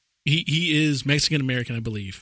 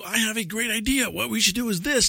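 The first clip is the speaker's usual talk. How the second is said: higher, enthusiastic mock pitch; lightly acted excitement; imitated eager pitch; 8 semitones higher than usual. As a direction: pitch it like an eager idea man. Acts out Chris pitching an idea.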